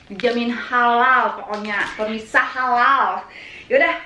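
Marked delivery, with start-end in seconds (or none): 3.7-4.0 high, cheerful call to eat; excited cheer. Real, not acted. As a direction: cheer that it's time to eat. Cheering before eating.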